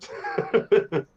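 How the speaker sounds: usual speaking voice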